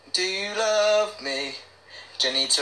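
ordinary delivery

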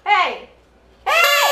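shouting. Real, not acted. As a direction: yell to get it back. Toddler grabs her chocolate.

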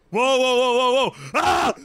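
startled yelling. Real, not acted. Gamer startled mid-game, yelling in alarm. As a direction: yelp as if caught off guard.

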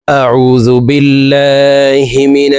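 long melodic chanted tilawat, every vowel stretched; slow Quran recitation. Acted. Teacher opens the lesson with slow melodic recitation. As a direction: intone it slowly like a reciter opening class.

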